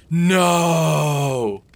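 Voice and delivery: long, loud, high wail of protest; dramatic cry. A narrator's reading. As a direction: cry out a drawn-out NO. Acted cry in story reading.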